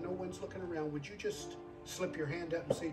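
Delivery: normal talking voice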